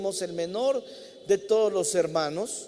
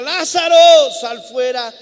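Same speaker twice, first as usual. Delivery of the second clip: loud call; 5 semitones higher, 14 dB louder than usual. Retold or imitated. Jesus calls Lazarus from the tomb, a loud commanding shout. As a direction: shout the name out high and strong. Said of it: "y grita".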